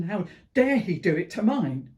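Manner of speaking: loud, indignant outburst; a bereaved mother's loud protest; cursing God. Acted. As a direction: hurl it out as an angry challenge. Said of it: "I cursed God".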